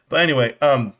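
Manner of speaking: ordinary talk, nothing marked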